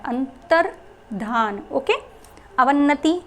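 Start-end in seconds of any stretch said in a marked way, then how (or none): 0.1-1.6 अंतर्धान drawn out slowly, ending on a clear धान; slow demo. Shown as a demonstration. Teacher slowly sounding out अंतर्धान for students. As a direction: stretch out अंतर्धान syllable by syllable. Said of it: "इसमें जो धान होता है".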